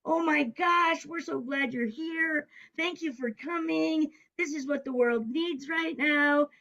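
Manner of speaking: high, drawn-out thankful gushing; gushing welcome. Retold or imitated. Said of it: "they said the same thing".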